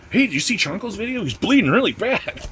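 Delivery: high, excited gossip voice; mock gossiping viewer. Imagined viewer gossiping about his accident, high and excited. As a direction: play a viewer spreading the news. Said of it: "Wouldn't that be a video".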